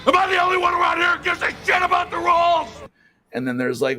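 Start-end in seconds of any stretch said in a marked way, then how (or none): 0.1-2.7 furious shout. Retold or imitated. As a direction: bellow it like an outraged bowler. Walter meme quote shouted.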